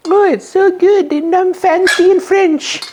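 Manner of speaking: hammed-up fancy French diner voice; posing as a fancy French bakery customer, mock French accent; French impersonation. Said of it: "my best French impersonation".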